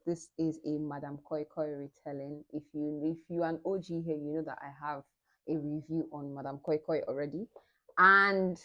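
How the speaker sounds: ordinary talk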